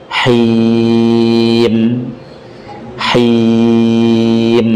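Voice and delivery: haim held four counts then closed on mim; measured tawassut length; four beats. Shown as a demonstration. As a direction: stretch hai for four beats and end on m. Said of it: "Ingat, jangan berlebihan".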